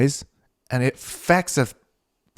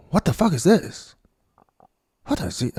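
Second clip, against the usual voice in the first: put-on failed Korean accent; 4 semitones higher than usual. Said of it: "I can't do Korean accent".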